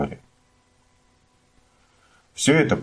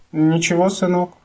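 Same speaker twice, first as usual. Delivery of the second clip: sleepy mumble; 12 semitones higher than usual. A narrator's reading. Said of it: "пробормотала во сне мама".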